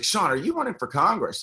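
a hint of an excited Italian mom calling her son; fiery Italian mom. Mother phoning her son about Congress, lightly voiced fiery mom. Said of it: "she's, like, this fiery Italian woman".

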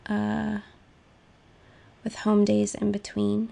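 normal talking voice

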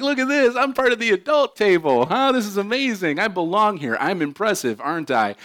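put-on high, proud little boy showing off; a child delighted with himself; smug kid voice. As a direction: boast like a kid at the grown-ups' table. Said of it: "and I'm like".

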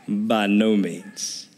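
slow, weighty "By no means"; stress on NO. Shown as a demonstration. Preacher reading Paul's reply to the church, slow and weighty. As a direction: lean hard on "no" and draw it out. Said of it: "Paul says in verse two".

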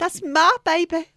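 put-on slow, high, loud drawling accent. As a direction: stretch it out in a hammed-up accent. Joke voice, later asked "What accent?.

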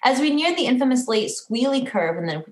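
ordinary delivery

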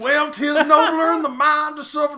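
high, loud Southern Hamlet; mock deep South accent. First actor to play Hamlet, in a mock Southern accent. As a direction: declaim Shakespeare in a hammed-up Southern twang. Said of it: "fucking deep south".

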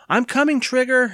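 put-on very high-pitched boyish call. A narrator's reading. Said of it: "Evan called".

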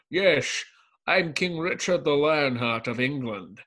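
slow, grandly announced Scottish impression; stretched-out Connery-style sibilants, hammed up; Sean Connery. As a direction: play a mock-regal movie-star king. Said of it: "my favorite Sean Connery what the fuck moment".

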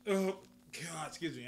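hiccuping between words; interrupted by a hiccup. Real, not acted. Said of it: "I got the hiccups".

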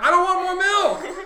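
high, loud mock-desperate refusal; protesting yell. Choking viewer refuses the director's milk, high protesting shout. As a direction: shout back in hammed-up protest.

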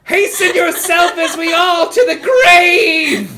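wailing high shout, stretched slow; spectral herald. Acted. Spectre from the phone urges the party to the grave, wailing shout. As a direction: boom it out like a doom-laden phantom. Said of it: "a giant spectral figure that says".

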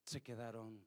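quiet, low delivery with long pauses; soft, spaced-out sermon; hushed preaching. Real, not acted. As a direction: drop the voice and let each phrase land.

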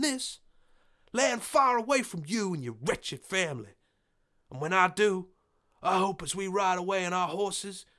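high, loud, bitter farmer's voice; defiant farmer. Acted. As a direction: spit out his vow of defiance. John voiced defying landlord.

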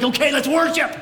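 high-pitched mock-eager announcement; hammed-up fake cheerfulness after a fight; forced cheer. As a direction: put on abrupt, over-bright enthusiasm. Sudden church cheer after family fight.

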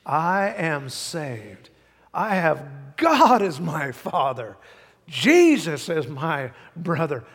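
slow, loud call to repeat; congregational declaration. Real, not acted. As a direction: lead the room in saying it, loud and steady. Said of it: "Would you say that with me".

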